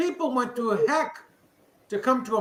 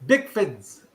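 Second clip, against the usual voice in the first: Bickfords with no R, Boston style; Boston accent; 4 semitones lower than usual. Shown as a demonstration. Friends asking how he says it, Boston accent. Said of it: "With, with the Boston accent".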